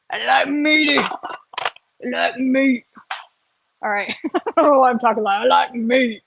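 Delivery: a goofy put-on voice; lightly put-on goofy voice; silly voice. Real, not acted. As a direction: play with a silly character voice. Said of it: "why I'm talking like that".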